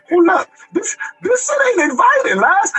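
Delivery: taken aback. Retold or imitated. Retold alarm at cold reception.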